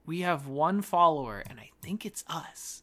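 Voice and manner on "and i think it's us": breathy hushed confession; whispered aside. Real, not acted. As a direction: whisper it as a secret joke. Joke about being their own follower.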